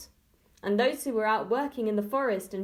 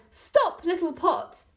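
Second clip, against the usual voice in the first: high, loud commanding shout; girl shouts the command to save the village; shouting as loudly as she could; 9 semitones higher, 4 dB louder, slower than usual. A narrator's reading. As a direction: shout it out with all your might. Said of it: "as loudly as she could".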